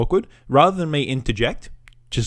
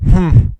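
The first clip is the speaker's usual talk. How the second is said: a short, louder defeated hum; defeated hmm; 5 semitones higher, 8 dB louder than usual. Retold or imitated. Beaten customer before leaving, a defeated hum. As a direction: deflate into one hum. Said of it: "way down to".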